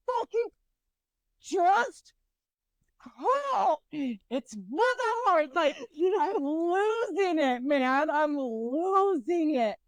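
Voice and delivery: high, drawn-out frustrated whine; acted losing it. Woman replaying her meltdown over curling hair, whiny exasperation. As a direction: stretch the words as if melting down. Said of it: "I lose it. I'm just like".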